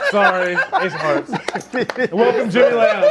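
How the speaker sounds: real laughter breaking through the words; laughing. Real, not acted. Host apologizing and welcoming a player, while laughing. Host cracks up at his slip.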